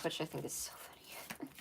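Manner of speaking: whispered amused aside; whispering. Real, not acted. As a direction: drop to a breathy whisper.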